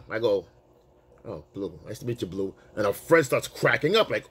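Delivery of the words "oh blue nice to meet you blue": chill. Retold or imitated. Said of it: "my demeanor that I was just chill".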